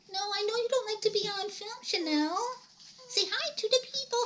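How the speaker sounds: high-pitched voice for cooing to a dog. Real, not acted. Talking to her dog.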